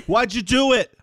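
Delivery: high, hammed-up New York whine; exaggerated New York complaint; New York accent. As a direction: play it like a pushy New Yorker. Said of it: "like a New York".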